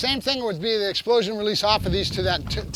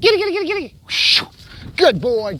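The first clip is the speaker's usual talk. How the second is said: high, loud, rapid-fire hyping; excited dog play; 8 semitones higher, 6 dB louder than usual. Real, not acted. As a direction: rev the dog up with playful noises.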